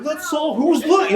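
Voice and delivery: high chirpy cartoon sleuth; mock Scooby gang bravado. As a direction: play it like a cheery cartoon detective. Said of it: "You're like".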